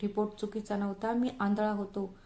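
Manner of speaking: ordinary delivery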